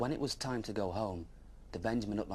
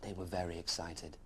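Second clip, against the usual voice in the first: ordinary delivery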